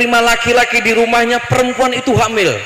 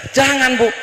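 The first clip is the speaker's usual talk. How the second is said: normal talking voice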